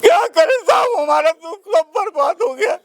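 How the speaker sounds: high-pitched tearful wail; real crying. Real, not acted. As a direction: break down and cry out in despair. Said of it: "आप रोइए मत".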